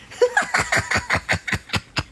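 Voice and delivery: high, rattling cackle; mischievous laugh. Real, not acted. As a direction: cackle at your own trick. Laughing at her own con.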